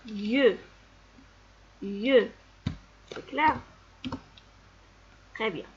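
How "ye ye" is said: "ye" as one gliding syllable with rounded eu; son isolé. Shown as a demonstration. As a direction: model the sound "ye" twice. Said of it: "comme dans le son". (« ye » isolé, comme yeu)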